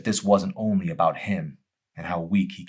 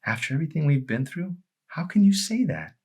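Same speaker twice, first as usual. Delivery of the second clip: stunned disbelief; 10 semitones higher than usual. Acted. Joel shocked.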